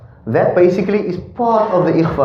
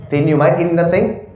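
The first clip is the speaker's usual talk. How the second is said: normal talking voice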